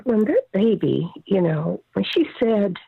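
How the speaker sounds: ordinary talk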